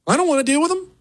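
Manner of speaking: very high-pitched complaining whine; mock whiny protest. Host voices objection to dealing with them, high mock whine.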